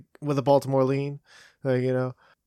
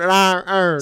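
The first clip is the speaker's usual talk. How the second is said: mock Baltimore accent, louder; 5 semitones higher, 5 dB louder than usual. Mimicking the viral video, in a mock Baltimore accent. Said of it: "So in Baltimore".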